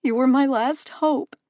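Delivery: despairing mother sobbing to the god; sobbing plea. A narrator's reading. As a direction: wail it through tears. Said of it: "she sobbed into her hands".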